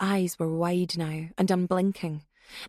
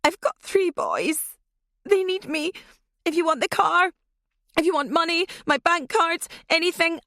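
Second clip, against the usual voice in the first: much higher and louder, panicked; desperate begging; 10 semitones higher, 5 dB louder than usual. Acted. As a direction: plead frantically for your life. Terrified hostage begging for her life.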